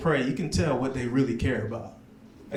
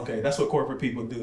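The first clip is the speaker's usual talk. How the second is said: usual speaking voice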